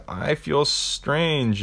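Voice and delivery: high, very slow and dazed. Retold or imitated. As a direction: drift off as the poison takes hold.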